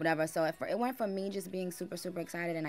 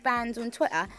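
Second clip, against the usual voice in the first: put-on high, loud London accent; 8 semitones higher, 9 dB louder than usual. Singer recounting fans' request, high London accent. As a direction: ham up an excited London accent. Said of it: "You've mastered the London accent".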